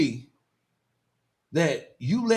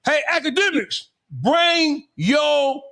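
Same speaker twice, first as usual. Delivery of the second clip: mock preacher call; 7 semitones higher, much slower than usual. Calling out like a preacher.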